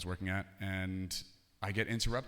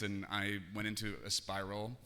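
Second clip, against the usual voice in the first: normal talking voice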